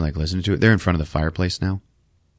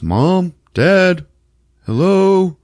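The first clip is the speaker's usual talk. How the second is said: loud, higher calls into the dark; calling out; 11 semitones higher, 5 dB louder, much slower than usual. Retold or imitated. Son searching the dark house, calling out loudly. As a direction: call for his parents through the house.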